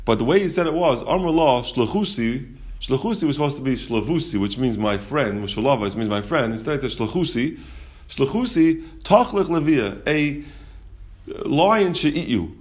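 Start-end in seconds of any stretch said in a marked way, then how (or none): none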